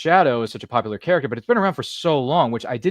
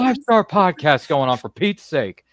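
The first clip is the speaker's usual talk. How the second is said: high, loud mock scolding from backstage; hammed-up boss telling the crew to pipe down; a host pretending to shush the crew backstage; mock stern shushing; 8 semitones higher, 6 dB louder than usual. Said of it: "his studio saying".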